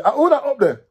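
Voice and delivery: high, loud shout up the tree; soldier's call. Acted. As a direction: demand who's hiding up there.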